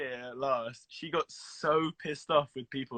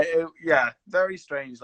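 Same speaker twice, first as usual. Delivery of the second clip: usual speaking voice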